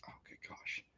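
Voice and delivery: whispering to himself; whispered aside. Real, not acted. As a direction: breathe the words under the breath. Aside while recalling the year.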